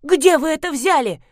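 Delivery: high, loud hysterical cry. A narrator's reading. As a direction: shriek it in panic. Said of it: "забилась в истерике".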